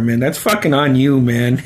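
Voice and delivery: normal talking voice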